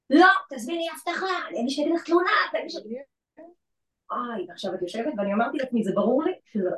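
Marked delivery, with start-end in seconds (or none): 0.1-2.8 high, forceful retold outburst; angry demand. Man refusing to leave dares her to call security, angry demand. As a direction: mimic an indignant man daring her. Acted quote of the screaming man.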